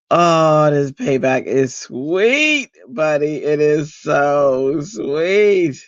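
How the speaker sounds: drawn-out, relishing gloat; savoring payback. Real, not acted. Grandmother relishing payback on her children, savoring drawl. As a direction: stretch out each SWEET with relish.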